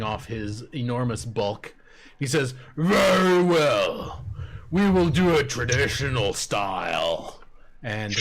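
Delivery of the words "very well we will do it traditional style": ogre champion. Acted. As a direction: boom it out like a proud ogre. GM voices the ogre.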